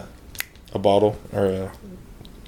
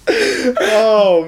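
laughing; 7 semitones higher, much slower than usual. Real, not acted. Laughing after ear bonk.

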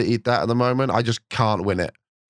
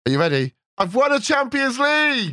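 excited; 13 semitones higher than usual. Real, not acted. Announcing big win excitedly.

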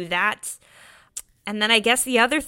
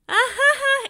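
high panicked little laugh; a quick nervous ha-ha; 5 dB louder, slower than usual. Retold or imitated. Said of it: "a split second of going".

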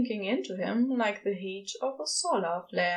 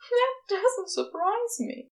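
a touch higher, fond; lightly voiced Aziraphale; 11 semitones higher than usual. A narrator's reading. Angel teasing Crowley fondly, lightly higher. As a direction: say it fondly, a little lifted. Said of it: "Aziraphale laughs".

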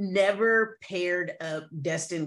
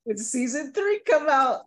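higher-pitched, hammed-up Top Boy accent; bad Top Boy accent; 6 semitones higher, 4 dB louder than usual. Shown as a demonstration. Host showing co-host their awful accent, hammed-up Top Boy accent. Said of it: "worse than my Top Boy accent".

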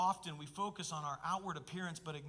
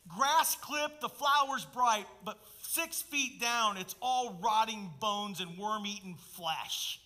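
louder, rising preacher's rebuke; preaching at full force; 4 dB louder, slower than usual. Acted. Preacher thundering Jesus' words at the Pharisees. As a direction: build it into a fiery rebuke.

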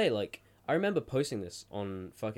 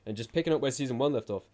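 words pushed out on a burp; 4 semitones higher, 5 dB louder, faster than usual. Real, not acted. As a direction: burp right through the phrase. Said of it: "Oh my God, I'm burping again".

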